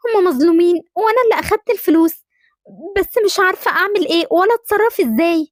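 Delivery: put-on a small girl who took the money and is scared; young girl. Daughter confides she stole the money, fearful guilty childlike voice.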